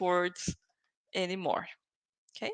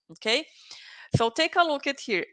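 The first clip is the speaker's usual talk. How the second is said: ordinary talk, nothing marked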